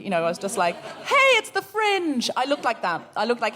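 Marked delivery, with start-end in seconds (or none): 1.0-2.3 high, slow, beaming announcement; bursting into song. Retold or imitated. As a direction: greet everyone like a festival performer. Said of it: "like I was about to burst into song".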